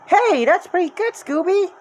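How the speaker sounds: put-on high, bright girl voice; girl impressed by a dog; Maisie voice. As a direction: praise the dog like a young girl. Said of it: "Maisie said".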